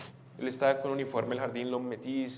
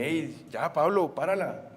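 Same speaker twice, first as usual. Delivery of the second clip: mildly stern dad, rising louder; retold scolding; 4 semitones higher, 5 dB louder than usual. Father to five-year-old son throwing a tantrum, firm scolding. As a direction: retell a father telling off his boy.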